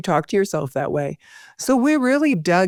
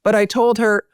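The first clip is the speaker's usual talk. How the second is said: ordinary talk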